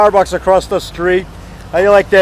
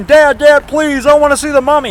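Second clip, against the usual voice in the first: put-on higher, begging little boy voice; pleading child; 6 semitones higher than usual. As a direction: beg like a five-year-old. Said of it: "down on my hands and knees crying".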